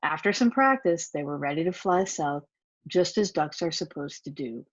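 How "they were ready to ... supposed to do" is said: ordinary delivery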